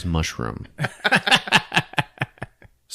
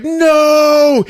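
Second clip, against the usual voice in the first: loud, high cry of no, much higher; shouted no; 9 dB louder than usual. Retold or imitated. Retelling the movie, dad's panicked high shout. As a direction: yell it as the dad rushes in. Father rushes to help.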